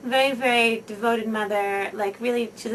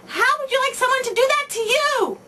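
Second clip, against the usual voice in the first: put-on much higher and louder, scolding the child; loud shout; 7 dB louder than usual. Shown as a demonstration. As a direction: yell it at the child gripping his arm. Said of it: "you shout loudly".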